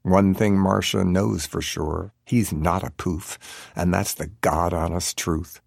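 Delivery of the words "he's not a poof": plain reading voice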